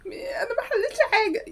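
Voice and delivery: high pitiful schoolgirl whine; whiny helpless student. Acted. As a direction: plead like she's already failing. Student pleading at exam start.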